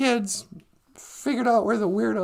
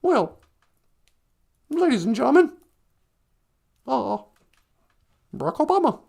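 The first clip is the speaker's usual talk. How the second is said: Obama impression; faster than usual. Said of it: "I can't do a Barack Obama impersonation".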